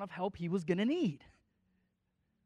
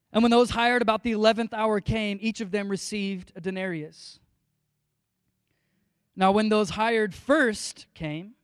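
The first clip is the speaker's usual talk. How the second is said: measured, public Bible reading; steady preacher's reading voice; scripture reading; 7 dB louder than usual. Acted. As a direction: read the passage to the congregation. Reads the parable aloud.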